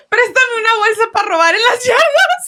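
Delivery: high, teary mock begging; playful high-pitched whimper; joking plea to mom. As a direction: whine it like a kid pleading with mom. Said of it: "And I'm like".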